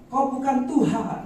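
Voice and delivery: high, loud, drawn-out cue; mock worship leader call. Preacher mimics a worship leader calling out the broken line, loud and high. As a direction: belt it out like a worship leader cutting the verse. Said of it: "W L bisa ngomong seperti".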